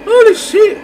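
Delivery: high loud shocked yell; shocked shout. Real, not acted. Caught off guard by a monster, high shocked yell. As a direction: blurt it out in fright. Sudden scare during play.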